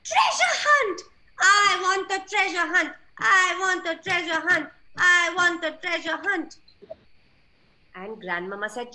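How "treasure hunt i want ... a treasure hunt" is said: high, loud thrilled duckling cries; duckling yelling with delight; excited shouting. A narrator's reading. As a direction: shout it like the best news ever. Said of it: "Ducky was very happy".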